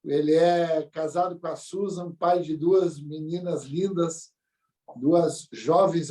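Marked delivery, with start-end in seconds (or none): none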